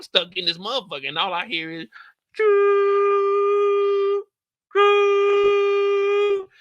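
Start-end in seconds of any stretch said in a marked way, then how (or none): 2.4-6.5 long high loud choo; train horn. Retold or imitated. Narrator imitating the train horn, high and loud.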